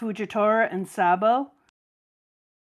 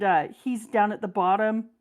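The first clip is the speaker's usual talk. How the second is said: ordinary talk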